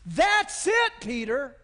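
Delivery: Jesus praising Peter's answer, elated shout; excited approval. Retold or imitated. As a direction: cheer him on, rising. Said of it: "And Jesus said".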